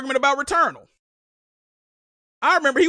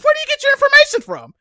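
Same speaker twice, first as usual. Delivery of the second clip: mock question; 12 semitones higher, 5 dB louder, faster than usual. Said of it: "they said".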